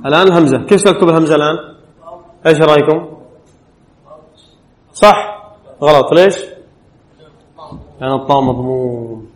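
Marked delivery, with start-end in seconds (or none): none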